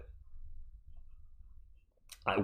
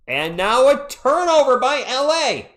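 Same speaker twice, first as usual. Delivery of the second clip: excited call; 11 semitones higher, 6 dB louder, slower than usual. Real, not acted. Live call of a turnover.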